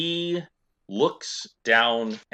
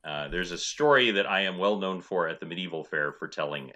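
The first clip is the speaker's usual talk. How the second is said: usual speaking voice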